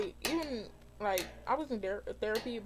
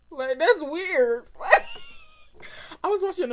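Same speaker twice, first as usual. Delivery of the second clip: laughing at herself; 8 semitones higher, 8 dB louder than usual. Real, not acted. Laughs at own crying story.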